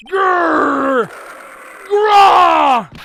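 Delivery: loud growl rising into a roar; tiger roar. Acted. Voices the tiger roaring.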